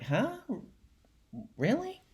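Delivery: acted bewilderment. [name]'s son told he'll never measure up, high and bewildered. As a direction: squeak out a small puzzled question.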